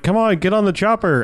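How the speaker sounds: high, urging call; mock calling-over. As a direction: imitate a soldier hurrying people aboard. Imitating soldiers calling civilians aboard.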